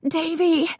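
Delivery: high, fear-choked cry; mother crying out in terror. A narrator's reading. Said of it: "It was so choked by fear".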